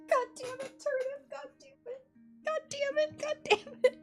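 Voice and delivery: very high squealing curse. Real, not acted. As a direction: squeal it in helpless delight. Exasperated squealing at the scene.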